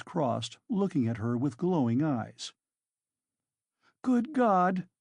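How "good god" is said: a sudden high cry of shock; startled cry. A narrator's reading. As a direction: let it burst out of her. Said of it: "broke from Sonia".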